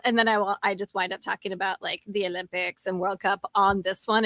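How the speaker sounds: ordinary talk, nothing marked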